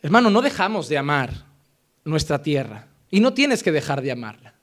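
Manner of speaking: normal talking voice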